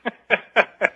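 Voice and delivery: knowing, resigned little chuckle, rising high; a short laugh that says we both know. Real, not acted. Guest answering the host's grim joke with a resigned chuckle. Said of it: "I liked your chuckle".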